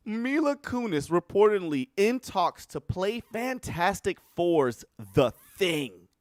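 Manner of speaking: announcing the news with disbelief; incredulous headline. Real, not acted. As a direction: read it as if you can't believe it.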